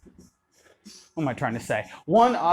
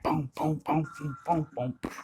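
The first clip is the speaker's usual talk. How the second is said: booms much softer, sliding between beats; 8 dB quieter than usual. Shown as a demonstration. Dance teacher showing in-between hits, soft booms. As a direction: breathe the booms softly in between. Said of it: "We can also play with in between that".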